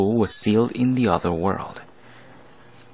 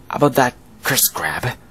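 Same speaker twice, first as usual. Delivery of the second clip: ordinary talk, nothing marked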